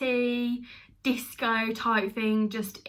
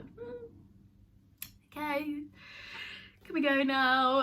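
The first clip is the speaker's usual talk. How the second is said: awkward politeness; 5 semitones higher, 4 dB quieter than usual. Retold or imitated. Awkward couple wanting to leave tour.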